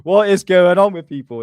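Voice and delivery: loud, rising disbelieving yell at people who fell for a hoax; exasperated shout. Retold or imitated. Vlogger retelling his reaction to believers, shouting in disbelief.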